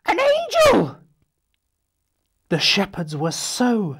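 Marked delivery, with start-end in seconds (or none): 0.1-1.0 high, loud amazed exclaim; wonder-struck shout; astonished cry. Acted. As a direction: gasp out the surprise. Sudden angel reveal.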